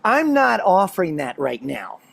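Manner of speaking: slow, measured, mild parental refusal; wife's firm refusal. Retold or imitated. Wife refusing her pestering son, slow and measured. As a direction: imitate a mother calmly shutting down a request. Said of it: "She looks right at him and goes".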